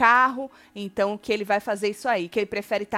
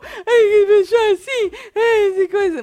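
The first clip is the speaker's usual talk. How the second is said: high, slow, wailing mock sob; imitating a crying contestant; 10 semitones higher, 8 dB louder than usual. Host mimics a weepy reality show winner, high mock wail. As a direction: ham up a contestant crying over his win. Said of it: "mais ou menos assim".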